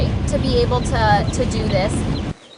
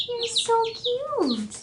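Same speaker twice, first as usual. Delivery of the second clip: "You're so cute" said slow, higher and much softer; 10 semitones higher, 8 dB quieter, much slower than usual. Real, not acted. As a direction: hush "You're so cute" gently, high and slow. Cooing at a chick, repeated.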